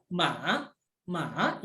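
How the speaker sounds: "ma" dipped low, then rising; third tone. Shown as a demonstration. Teacher gives the word for horse, dipping third tone. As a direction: sink low on "ma" and come back up. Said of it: "kalau nadanya nada tiga".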